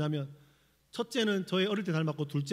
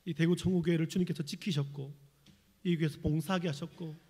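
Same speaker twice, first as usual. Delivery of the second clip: pastoral prayer; 5 dB louder than usual. Acted. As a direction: lead the congregation in prayer. Leads closing prayer.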